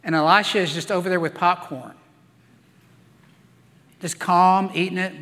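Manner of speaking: usual speaking voice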